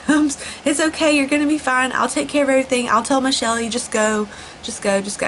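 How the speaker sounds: calm her down like a kind coworker would; soothing coworker. Retold or imitated.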